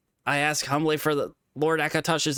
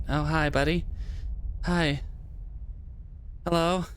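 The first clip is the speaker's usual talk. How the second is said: bright, higher friendly greeting; open and friendly; 5 semitones higher, 3 dB quieter than usual. Real, not acted. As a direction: greet a little buddy warmly.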